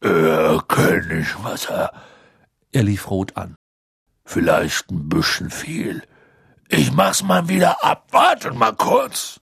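a bashful gamekeeper covering up; embarrassed Hagrid. A narrator's reading. Narrator voices embarrassed Hagrid.

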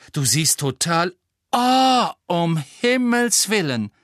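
rising into a high, loud gasp of outrage; shocked exclamation. Acted. Hermine spotting the notice on the board, shocked. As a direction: catch sight of it and blurt it out.

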